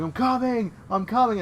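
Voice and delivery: hammed-up panicked I'm coming; mock urgent call.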